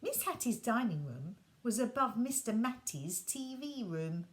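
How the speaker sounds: ordinary delivery, nothing marked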